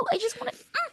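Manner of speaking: cute squeal. Real, not acted. Overwhelmed by cuteness.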